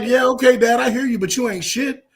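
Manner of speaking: high, loud imitation of grown kids; mock back-talk. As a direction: talk back to Dad, hammed up. Voicing grown kids answering back to dad.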